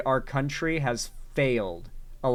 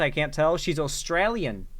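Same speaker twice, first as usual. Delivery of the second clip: ordinary delivery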